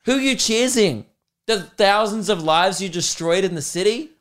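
high-pitched scolding challenge; mock indignation. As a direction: confront the celebrating heroes, appalled. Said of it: "I'm just like".

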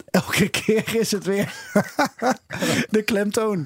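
slow, laughing mock exasperation; amused teasing. Real, not acted. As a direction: tease a colleague through laughter. Teasing co-host about name stress.

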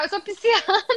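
high teasing mock yell, laughing; laughing imitation of someone crying out. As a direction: tease a friend with a pretend high-pitched cry. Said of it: "a Manu vai gritar".

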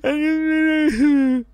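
high, drawn-out sob; hammed-up ugly cry; mock crying. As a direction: wail it slowly like a fake sob. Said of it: "and then I just bawled".

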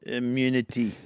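put-on clipped South African accent, low and quiet. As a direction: quote it like a South African villain. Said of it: "can we hear your South African accent again".

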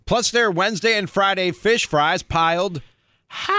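ends in a huge high yell on high. Shown as a demonstration. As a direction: belt the word high as a yell. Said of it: "You sounded like".

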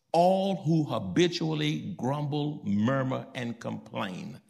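normal talking voice

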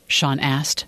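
ordinary delivery, nothing marked

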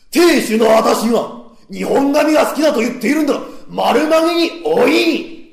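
high, loud husband yelling at wife; husband's angry shout. Acted. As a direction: bellow the order at her. (亭主が女房に怒鳴る、高く大声で)

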